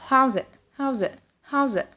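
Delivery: "it" with the final T left unreleased; "How's it" linked, swallowing the final T; dropped T. Shown as a demonstration. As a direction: stop short on "it" without sounding the T. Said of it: "We didn't pronounce T in it".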